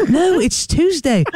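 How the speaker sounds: put-on a child correcting a muddled dad; high kid voice explaining gently; mock kid answering.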